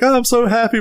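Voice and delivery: high, singsong cartoon bear; mock-cheerful high voice; happy gummy bear. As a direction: chirp it like a carefree cartoon critter.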